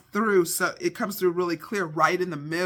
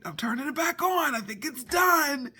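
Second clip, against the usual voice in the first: announcing call; 8 semitones higher than usual. Retold or imitated. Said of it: "he's like".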